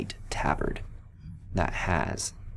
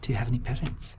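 whispered question, higher; gentle whisper; 5 semitones higher, much faster than usual. Acted. Paladin gently asking the orphan, whispered.

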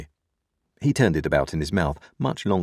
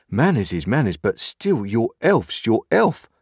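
aghast insistence, higher at the end; 5 semitones higher, 4 dB louder than usual. A narrator's reading. Joe worrying over Pip's health, aghast. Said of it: "said Joe, all aghast".

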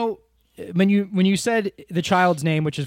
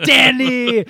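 higher, louder yelled name; mock scream; 6 semitones higher, 7 dB louder than usual. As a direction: belt the name out like Arnold. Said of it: "he's always screaming".

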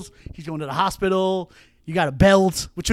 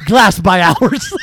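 mocking laugh; 10 dB louder, much slower than usual. Mocking the phrase.

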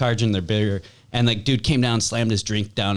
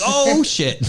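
startled; 3 dB louder than usual. Retold or imitated. Reacting to the shouting.

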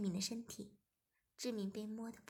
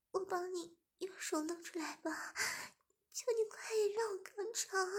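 higher pleading voice; bargain, then beg; high pleading woman's voice; 8 semitones higher than usual. A narrator's reading. Zhimin pleading.

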